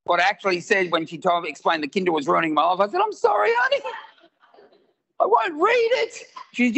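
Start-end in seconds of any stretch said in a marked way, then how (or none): 3.0-6.2 very high, submissive husband voice; meek apology. Retold or imitated. Husband to wife about the Kindle, comically meek apology. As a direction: apologise meekly in a tiny high-pitched voice.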